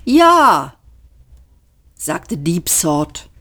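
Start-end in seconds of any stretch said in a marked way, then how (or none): 0.0-0.8 high, loud, patronizing yes; long-suffering. A narrator's reading. The computer answers the foolish men with strained patience. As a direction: humour the idiots. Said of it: "in einem Ton, als ertrüge er es mit Freuden".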